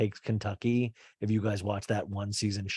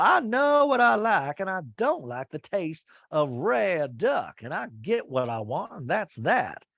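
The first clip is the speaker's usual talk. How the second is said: high, firm, imitating a stubborn lady; a hint of a proud Southern lady; stubborn old lady; 6 semitones higher than usual. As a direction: Miss Patricia refusing rare duck, firm mock voice. Patricia insisting on well done.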